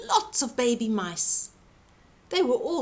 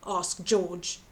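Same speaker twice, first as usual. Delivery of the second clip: ordinary delivery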